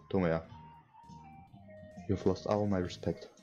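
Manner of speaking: usual speaking voice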